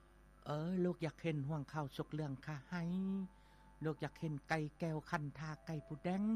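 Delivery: put-on girl's pleading voice. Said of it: "นางก็ขอว่า".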